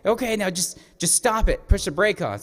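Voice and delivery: high, loud acted-out order; urgent instruction. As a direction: imitate urgently calling a halt. Grandfather urgently telling child to stop.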